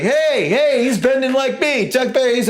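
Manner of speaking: very high, delighted call. Retold or imitated. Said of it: "[name]'s like".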